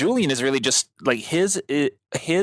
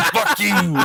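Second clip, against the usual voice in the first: laughing orc; 7 semitones higher, 3 dB louder than usual. Pretend. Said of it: "it's like".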